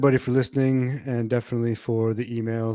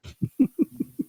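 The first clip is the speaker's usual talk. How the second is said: sudden high laugh; high burst of laughter. Real, not acted. Laughing at the mispronunciation.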